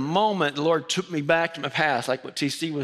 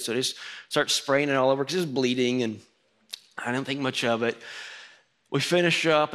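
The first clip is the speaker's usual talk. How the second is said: usual speaking voice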